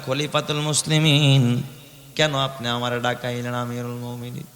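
hushed, timid question; inwardly afraid. Acted. Frightened woman asking the caliph why he summoned her, afraid. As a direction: speak softly, trailing low with hidden fear. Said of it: "মহিলা ভিতরে ভিতরে ভয়".

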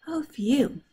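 breathy whisper of relief. Acted. Relieved listener hearing people recover, whispered phew. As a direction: let out a hushed phew. Relief that everyone gets better.

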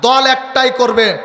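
usual speaking voice

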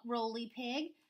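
usual speaking voice

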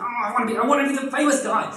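high, pleading mock whine; a hint of a whiny wannabe actor. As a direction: play the needy wannabe star. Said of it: "And he's like".

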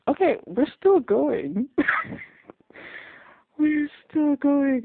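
high, loud, drawn-out astonishment with a laugh; amused disbelief. Real, not acted. Viewer reacting as the scene keeps going, amused disbelief.

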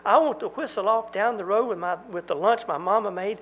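high, pleading outcry; desperate outburst. Acted. Jack pleading with the twins, high desperate outcry. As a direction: pour out longing desperately.

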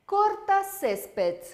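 "Corta césped" bem devagar, mais agudo; slow model word. Shown as a demonstration. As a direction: alongue "Corta césped" para repetir. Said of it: "como é que vai ser?". ("Corta césped" lento e mais agudo)

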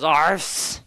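slow lisping tiger growl. Retold or imitated. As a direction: growl with a lisp. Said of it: "He growls with a lisp".

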